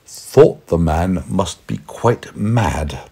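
usual speaking voice

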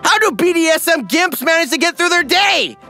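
usual speaking voice

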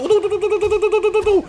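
rapid high oddio in a panic; panicked oddio. Real, not acted. Gamer panicking as the enemy attacks, rapid high oddio. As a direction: gasp it out over and over. Panicked repeating during fight.

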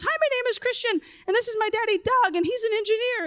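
high, sing-song preschooler introduction; little kid voice. Retold or imitated. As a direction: mimic a small boy proudly presenting his dad. Preschooler introduces his dad.

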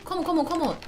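ordinary delivery

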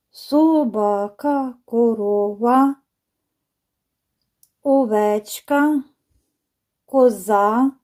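"Собака, корова, овечка, коза", each drawn out slowly to show its stress; demonstrating word stress. Shown as a demonstration. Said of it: "наголос іде на останній склад".

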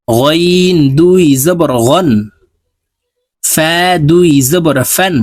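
slow sing-song letter drill, each line rising; tajweed recitation drill. Shown as a demonstration. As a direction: chant each letter for students to repeat. (ধীর সুরেলা তাজবিদ উচ্চারণ অনুশীলন)

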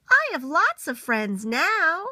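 little beaver sharing happy news. Acted. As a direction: announce it with joy. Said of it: "said it again very loudly".

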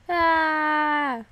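drawn-out "aah" of pretend alarm; loud, playful scream while fleeing; mock scream. Gamer fleeing a screaming character, mock scream.

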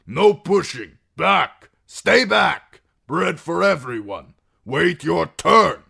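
high, loud, slow command shouted at the crowd; shouting. Acted. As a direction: bark the order over the crowd, slowly. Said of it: "they shouted".

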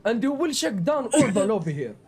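thick put-on Russian accent, broken English; joking Russian accent; fake Russian accent. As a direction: play a boastful Russian gamer in a heavy Russian accent.

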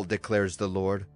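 plain reading voice

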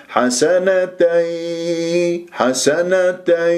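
حسنتي drawn out very slowly; slow recitation. Shown as a demonstration. Tajweed teacher modelling حسنتي, very slow. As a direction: linger on every letter of حسنتي. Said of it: "يو مرققة".

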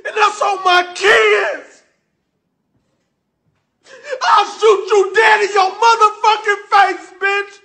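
high, tearful furious shouting; screaming threats through real tears; sobbing, furious shout at the tenant who trashed the house; crying rage. Real, not acted. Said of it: "I'm mad as a motherfucker".

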